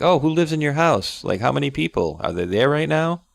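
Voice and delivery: a hint of an inquisitive machine quizzing a kid; nosy AI. AI quizzing a child about the house, nosy. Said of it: "he said the A I was saying".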